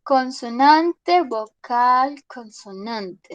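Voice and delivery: each word drawn out slowly; slow, deliberate classroom breakdown; slow spelled-out pattern. Shown as a demonstration. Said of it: "Esta termina en".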